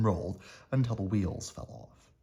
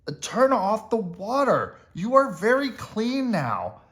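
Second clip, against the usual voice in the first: high, louder protest; crying out; 11 semitones higher, 4 dB louder than usual. A narrator's reading. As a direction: townsfolk shout at the mouse. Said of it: "The people in the town cried".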